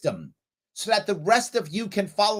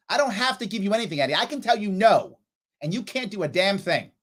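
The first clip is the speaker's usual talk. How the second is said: normal talking voice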